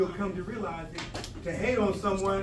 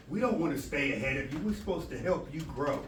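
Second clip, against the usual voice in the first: ordinary talk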